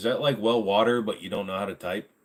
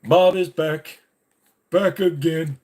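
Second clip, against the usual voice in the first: higher, louder dummy voice; ventriloquist dummy; 8 semitones higher, 6 dB louder, slower than usual. Pretend. Dummy announcing Bob is back to chat, higher and louder. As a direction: puppet talking without moving lips.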